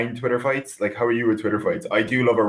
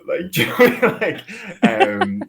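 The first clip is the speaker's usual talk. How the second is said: laughing through the words, pitched up high; 10 semitones higher, 3 dB louder than usual. Real, not acted. Musician explaining lockdown writer's block to the host, laughing. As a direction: giggle helplessly while trying to carry on.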